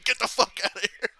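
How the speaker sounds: high, loud imitated yell; hammed-up jeering shout; mock send-off shout. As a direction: holler after someone as they leave, play-acted. Imitating wrestler shouting up the ramp.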